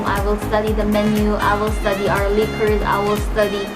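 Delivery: usual speaking voice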